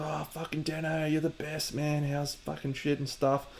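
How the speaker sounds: lightly voiced starstruck admirer, gushing; excited fan. Imitating fans who met him, a gushing starstruck voice. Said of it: "They were".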